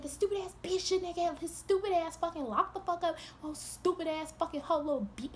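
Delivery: imitating a heated tirade; retold angry insults. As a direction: rattle off insults in a mock rage. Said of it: "calling him all different".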